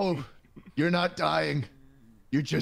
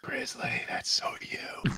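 soft unvoiced whisper; 10 dB quieter, slower than usual. Real, not acted. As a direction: breathe it as a secret aside. Whispered aside.

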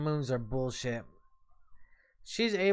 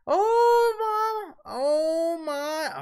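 mock wail; 12 semitones higher, 4 dB louder, much slower than usual. Real, not acted. Dog interrupts, mock wail.

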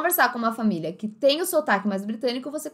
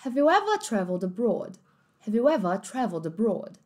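sentence in a light British English accent. Shown as a demonstration. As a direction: read it like a British speaker. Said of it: "um inglês mais britânico talvez soasse desse jeito". (sotaque britânico)

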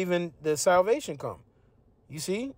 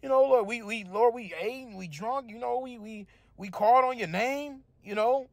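mock pleading; 6 semitones higher than usual. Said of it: "they gonna be saying".

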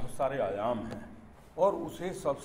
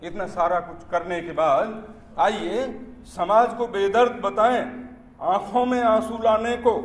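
declaimed verse, higher and fuller than chat; poem recitation; 6 semitones higher, 8 dB louder than usual. Acted. Poet reciting satire on Dalit sympathy to an audience, declaimed recitation. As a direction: declaim it in measured phrases, lifting the line ends.

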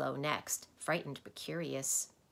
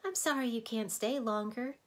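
sadly; 7 semitones higher, 3 dB louder than usual. Acted. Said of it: "said Alec sadly".

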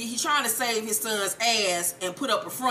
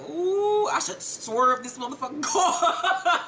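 high drawn-out "ooh", slow seething threat; mock exasperation; 6 semitones higher, slower than usual. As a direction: act out barely contained irritation. Acted exasperated threat in the car.

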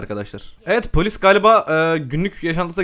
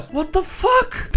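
stunned disbelief; much slower than usual. Real, not acted. His Sim vanished.

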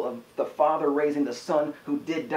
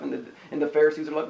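ordinary talk

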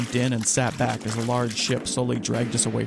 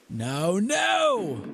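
high, drawn cry of despair; screamed; 7 semitones higher than usual. A narrator's reading. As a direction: cry out in alarm, pitched high. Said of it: "Hartness screamed".

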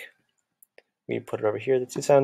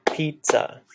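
"Pizza." as two higher beats; clapped syllables; 4 semitones higher than usual. Shown as a demonstration. Teacher clapping out a word for music class. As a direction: clap out "Pizza." piz-za. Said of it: "Clap it out".

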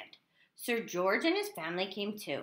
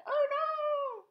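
a high, startled "Oh no!"; surprised. Retold or imitated. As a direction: gasp it out as if the dragon startled you. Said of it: "Look how surprised everybody looks".

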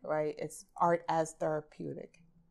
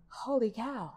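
higher, hushed "wow" moment; retold amazement; 5 semitones higher than usual. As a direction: relive the astonishment of seeing it firsthand. Retold amazement at witnessing proof.

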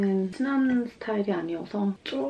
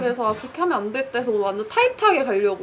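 talking through a face mask; 3 semitones higher, 8 dB louder, faster than usual. Real, not acted. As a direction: voice muffled by mask. Seen 1: speaker wears face mask throughout; reference frames show unmasked face.

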